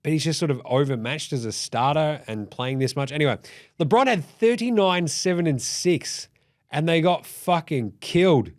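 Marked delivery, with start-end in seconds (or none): none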